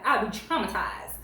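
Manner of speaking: ordinary talk, nothing marked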